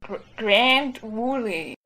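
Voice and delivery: put-on slow, halting stab at a country accent; attempted country accent. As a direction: try a drawn-out Southern country accent. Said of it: "say it in a really country accent".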